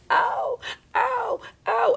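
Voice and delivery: a high, hammed-up ow ow ow; mock pain cries. Someone getting hurt, hammed-up cries of pain. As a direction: yelp as if hurt. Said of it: "someone's like".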